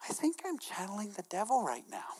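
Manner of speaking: preacher whispering a guilty inner thought to the church. Retold or imitated.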